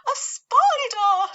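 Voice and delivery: high, slow, loud shriek of disgust; shrieking cry. Retold or imitated. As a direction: scream it at the sight of a spider. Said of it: "she cried".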